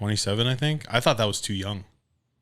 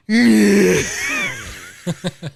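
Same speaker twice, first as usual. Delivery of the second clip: much higher, louder mock wailing sob; imitated ugly cry; 13 semitones higher, 10 dB louder than usual. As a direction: ham up a big ugly sob. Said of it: "ugly cry".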